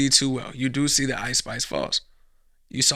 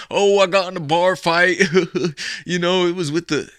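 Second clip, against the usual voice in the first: louder casual brag; mock bragger; 4 semitones higher, 6 dB louder than usual. Bragging to a coworker, louder and higher. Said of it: "mocking bragging at work".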